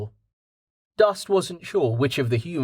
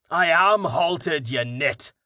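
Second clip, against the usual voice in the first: drawn-out, mildly raised retort; slow, scornful holler; 4 semitones higher, slower than usual. A narrator's reading. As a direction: holler back, stretching the first words. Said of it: "Carten hollered back".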